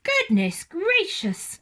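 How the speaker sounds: high, slow, loud astonished cry; exclaimed. A narrator's reading. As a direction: gasp it out in shock. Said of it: "exclaimed Missus Bird".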